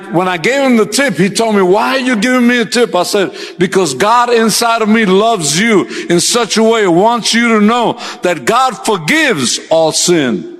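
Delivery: rising preacher's call, high and lifted; fervent preaching rise. Real, not acted. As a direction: preach it up to the congregation. Preaching build-up.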